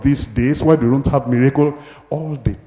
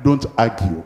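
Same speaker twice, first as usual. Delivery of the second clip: normal talking voice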